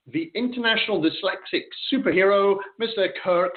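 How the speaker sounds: showman build-up to a big name; grand entrance announcement. Acted. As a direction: announce the guest like a ringmaster. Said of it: "That was great. A great introduction".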